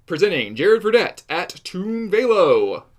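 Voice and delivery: loud booming arena announcer; fighting game announcer. Pretend. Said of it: "that announcer voice".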